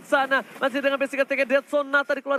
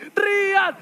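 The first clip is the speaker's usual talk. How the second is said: shouting; 7 semitones higher, 5 dB louder than usual. Real, not acted. Caster shouts a player name.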